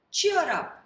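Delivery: cheerful call. Acted. George comforts goat.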